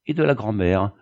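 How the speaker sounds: ordinary delivery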